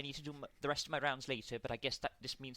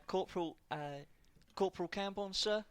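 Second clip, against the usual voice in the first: put-on Welsh accent; 2 semitones higher, 7 dB louder than usual. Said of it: "most people just call me Welshie".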